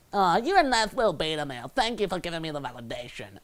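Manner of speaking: a lightly put-on girl's voice, condescending, patting him on the head; mock her sweetly, as if he's harmless; girl's dismissive inner voice. Coach voicing the girl's bored thanks to a nice guy. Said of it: "she's basically like".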